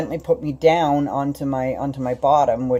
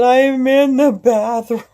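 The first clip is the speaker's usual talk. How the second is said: high, loud, slow call; calling back; 10 semitones higher, 7 dB louder, slower than usual. Retold or imitated. Wife stuck in a squat, calling back loudly. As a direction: answer him from the bathroom.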